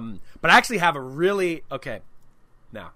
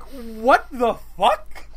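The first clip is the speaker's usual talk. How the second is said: mock disbelief; 5 semitones higher, 3 dB louder, much slower than usual. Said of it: "I'm sitting there like".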